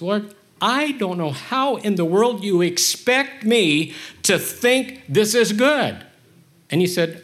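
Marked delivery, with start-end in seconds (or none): 0.0-6.0 high, slow, exasperated plea; pleading complaint to God. Retold or imitated. Speaker protesting to God about the bad thing, high and slow. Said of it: "the conversation went something like this".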